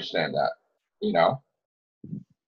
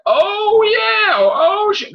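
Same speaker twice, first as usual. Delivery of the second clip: much higher, louder giddy gasps; mock thrilled fans; 4 dB louder, slower than usual. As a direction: gush like a fan hyped by a twist. Fans' old excitement over finales.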